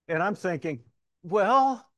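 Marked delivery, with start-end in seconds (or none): 1.2-1.9 emphatic well. Retold or imitated. Inner retort to correction.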